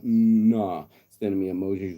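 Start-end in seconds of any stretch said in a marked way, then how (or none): 0.0-0.8 a loud, dismissive "Mm, nah". Retold or imitated. Girl brushing off his advice, loud and dismissive. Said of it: "She was like".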